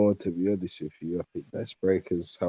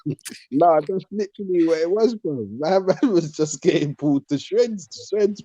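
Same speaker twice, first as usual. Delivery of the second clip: higher and louder, breaking into laughter; laughing; 6 semitones higher, 6 dB louder than usual. Real, not acted. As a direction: laugh through the words in disbelief. Laughing at Gomez being outrun.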